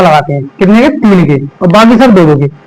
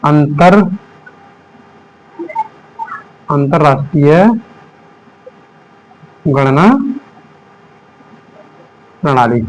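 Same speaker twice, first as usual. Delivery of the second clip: slow, word by word; 5 semitones lower, 5 dB quieter, slower than usual. Shown as a demonstration. Teacher repeats name word by word.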